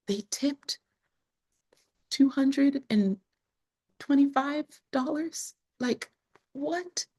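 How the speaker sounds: high, slow, disbelieving; stunned disbelief. Retold or imitated. Recalling the moment she saw the big tip, high and disbelieving. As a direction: let each word land in disbelief, pitched well up.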